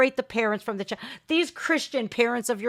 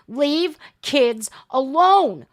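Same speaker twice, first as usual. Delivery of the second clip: slow, firm slogan; much slower than usual. Real, not acted. Reactor restating his slogan defiantly, slow and firm. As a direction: plant your feet and say it slowly.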